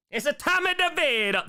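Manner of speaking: loud, high, hammed-up Italian accent; over-the-top Italian accent. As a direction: exclaim it in a cartoon Italian accent. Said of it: "That's just Italian".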